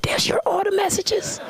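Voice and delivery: high, startled disbelief; shocked. Retold or imitated. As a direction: gasp it out in disbelief. Shocked inner reaction.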